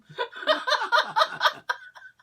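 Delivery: high, loud cackling laughter; real burst of laughing. Real, not acted. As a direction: crack up at your own story. Laughs at retold quote.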